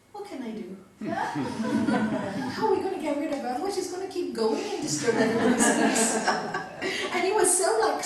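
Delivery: chuckling while explaining; laughing. Retold or imitated. Master laughing after throwing student out, amused laugh. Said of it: "he just burst out laughing".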